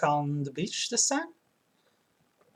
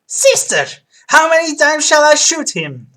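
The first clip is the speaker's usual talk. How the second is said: loud, high-pitched angry-brother voice; armed brother; 11 semitones higher, 12 dB louder than usual. Acted. Maid's brother threatening to shoot, loud high character voice.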